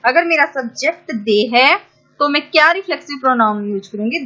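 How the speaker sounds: ordinary talk, nothing marked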